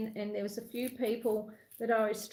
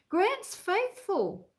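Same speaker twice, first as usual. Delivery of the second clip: high, slow, drawn-out name; sing-song greeting; 9 semitones higher, 4 dB louder, much slower than usual. Real, not acted. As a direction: call the name out brightly. Greeting viewer on live stream.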